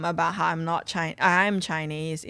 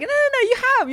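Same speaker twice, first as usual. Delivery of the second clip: put-on high, loud drunken insistence; drunk fan insisting; 7 dB louder, much faster than usual. Comedian imitates drunk fan insisting, high and loud. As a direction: ham up a pissed bloke who won't listen.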